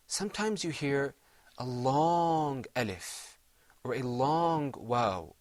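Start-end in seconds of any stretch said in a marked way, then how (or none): 1.6-3.4 long alif held slowly; drawn-out alif. Shown as a demonstration. Teacher showing students the long alif, drawn out. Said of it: "when you're listening to the Qur'an". 3.8-5.3 "long waw" stretched slowly; drawn-out waw. Shown as a demonstration. Teacher showing students the long waw, drawn out. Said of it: "when you're listening to the Qur'an".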